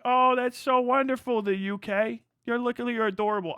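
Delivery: high-pitched condescending coo; mock adoring. American humouring the UK's war stories, condescending. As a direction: gush as if to a cute child.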